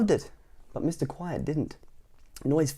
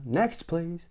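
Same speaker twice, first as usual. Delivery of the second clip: brusque call; 6 semitones higher than usual. Acted. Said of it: "bellowed the greengrocer".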